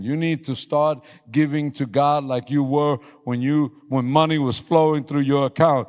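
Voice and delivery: high, loud, driving sermon climax; full-force preaching. Acted. Pastor shouting his vow to God to the church, high and loud.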